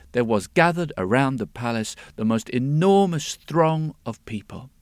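ordinary delivery, nothing marked

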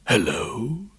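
dragon voice. Acted. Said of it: "said the dragon".

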